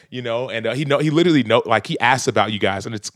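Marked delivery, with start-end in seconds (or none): none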